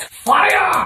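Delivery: high shouted call. Acted. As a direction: bark out the cue to the oven. Shouting oven cue.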